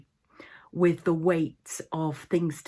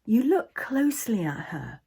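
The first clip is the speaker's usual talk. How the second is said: guided meditation. Acted. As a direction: guide listeners into the imagined scene, unhurried.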